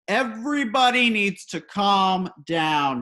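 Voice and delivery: loud, slow call for calm; a garbage man imitated shouting over the crowd. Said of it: "he shouts".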